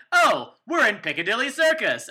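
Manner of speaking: hammed-up tourist announcement; mock touristy. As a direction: sell the fake location. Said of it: "we would just be like".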